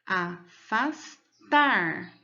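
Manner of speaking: "Afastar" in three slow pieces, last syllable TAR strongest; syllables, stress on TAR. Shown as a demonstration. Teacher repeating "Afastar" to reveal its strongest syllable, slow and spaced. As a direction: pause between a, fas and TAR in "Afastar", weighting the end. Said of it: "Qual é a sílaba mais forte?".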